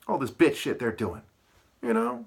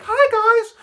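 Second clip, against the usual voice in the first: shrill high mock greeting, much louder; high, loud mock hello; mock old hag; 10 dB louder than usual. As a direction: squeal it like a fake-sweet old lady.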